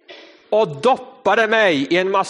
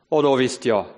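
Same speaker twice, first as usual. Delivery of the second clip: normal talking voice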